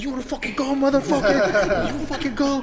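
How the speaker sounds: high, strained fighting-dad imitation; mock angry challenge. Retelling dad's knife-out challenge.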